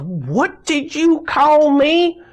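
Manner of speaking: hammed-up nurse yelling at a patient; indignant yell. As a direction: shriek it back, offended and furious. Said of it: "just yells".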